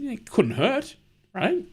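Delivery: light high reply. Retold or imitated. Urging the friend to move the printer, high and easygoing. His own retold reply.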